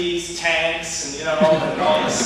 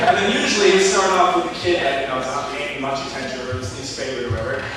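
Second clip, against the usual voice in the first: ordinary talk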